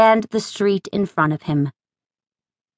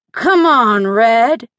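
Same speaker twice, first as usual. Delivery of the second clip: pleading, wheedling whine, a bit louder; a drunk whining for her attention; drunken whine; 2 semitones higher, 4 dB louder, much slower than usual. Acted. Town drunk whining at the young woman, wheedling. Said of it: "the drunk whined".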